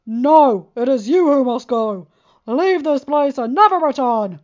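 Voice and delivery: booming warning. A narrator's reading. Thunderous voice answers.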